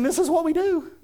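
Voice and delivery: high-pitched imitation of a little boy; six-year-old boy. Preacher repeating his son's answer to the congregation, high child impersonation. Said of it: "Here's a six-year-old boy saying".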